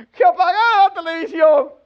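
high, loud mock child voice; child answering reluctantly. Child admitting the TV order to a parent, high mock whine. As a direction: blurt it out like a cornered kid.

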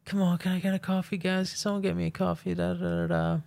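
high, fast whiny pleading; a stranger pesters the film crew for coffee, fast, high, whiny; imitating a pestering woman. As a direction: rattle off the begging in a high nagging voice. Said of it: "She comes over to us".

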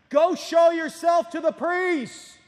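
high, loud, slow shout; yelling. Retold or imitated. Jesus yells back to the distant lepers, loud and slow. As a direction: call it out across the distance. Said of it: "he yells at them".

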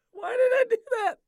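squealing high through laughter; real giggling fit, voice way up high; helpless laughter. Real, not acted. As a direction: wheeze the words out while cracking up. Cracking up at own flub.

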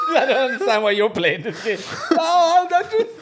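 laughing loudly while talking. Real, not acted. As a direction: burst out laughing mid-sentence. Laughing at friend's name.

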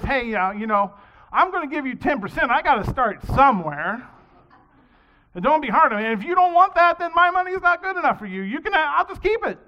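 building from loud to high, huffy outburst; a swimmer talking God down, ending in a huff; indignant haggling. Acted.

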